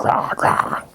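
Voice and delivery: two mock growls in a row; unvoiced playful monster growls; imitated growl. Marge growling at screaming girls, mock growl. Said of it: "She, like, growls".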